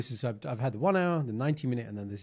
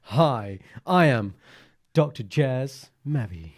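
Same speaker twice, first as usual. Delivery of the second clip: louder, slow smooth self-introduction; imitating himself; 7 dB louder, much slower than usual. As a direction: deliver it like a smooth rock star. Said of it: "exactly that when I think about doing an impression of you".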